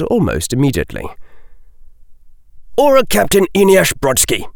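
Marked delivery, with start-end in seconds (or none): 2.8-4.5 brisk, higher-pitched official announcement; crisp, fast self-identification; said clearly. A narrator's reading. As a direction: state name and rank quickly and clearly. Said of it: "the man said clearly".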